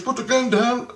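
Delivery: high-pitched mock command; imitated urgent order. Man ordering the armed teller to disarm, urgent.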